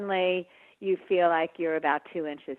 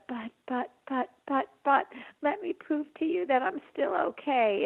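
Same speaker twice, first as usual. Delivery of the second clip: mock defensive pleading; 9 semitones higher than usual. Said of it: "it's the argument that's so hard".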